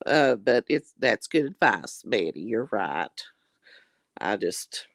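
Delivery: usual speaking voice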